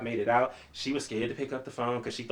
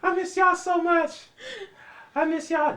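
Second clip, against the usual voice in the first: high, slow, hammed-up wail with laughter breaking through; mock crying; 7 dB louder, slower than usual. Watching The Color Purple, missing his grandparents, mock sobbing. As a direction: overplay the weepy tears for a laugh.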